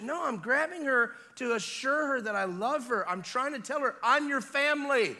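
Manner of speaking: impassioned preaching at full force. Real, not acted. Preacher as loving father assuring his child, impassioned full-force preaching.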